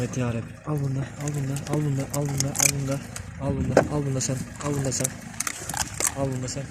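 feed them quietly before the strong ones come; hushed urging. Real, not acted. Slipping food to weak dogs before strong ones arrive, hushed.

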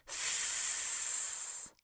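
long voiceless "S" hiss; S sound. Shown as a demonstration. Teacher asks learner to say the S sound, sustained sss. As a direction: sustain "S" as sss, without a vowel. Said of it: "Say the S sound".

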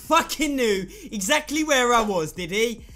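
loud frustrated rant; sarcastic furious yelling; angry shouting. Real, not acted. Raging after dying in game.

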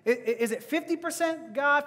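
normal talking voice

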